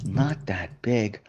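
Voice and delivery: very soft, slow repeat to oneself; hushed, drawn-out disbelief; stunned echo. Retold or imitated. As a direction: say it small and slow, sinking in. Stunned actor echoes verdict in hallway.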